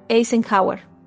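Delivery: ordinary delivery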